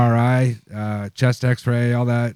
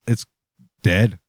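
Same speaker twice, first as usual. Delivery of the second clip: ordinary talk, nothing marked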